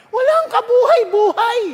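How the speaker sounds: ordinary talk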